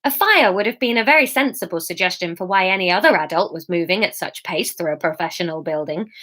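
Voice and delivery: plain reading voice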